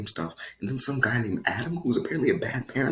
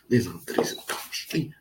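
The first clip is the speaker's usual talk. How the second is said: usual speaking voice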